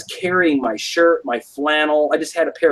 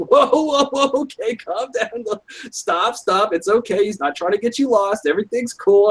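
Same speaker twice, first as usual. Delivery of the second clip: high, soothing, placating voice; calming friend; 8 semitones higher than usual. Retold or imitated. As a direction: talk down a raging drunk. Friend restraining a drunk buddy.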